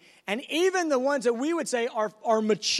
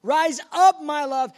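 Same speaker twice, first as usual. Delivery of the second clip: loud call; 5 semitones higher, 8 dB louder than usual. Acted. Jesus calls the bride upward.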